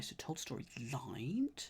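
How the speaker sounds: whispered to himself; whispering. Real, not acted. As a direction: breathe the answer out, unvoiced. Muttering the answer to himself.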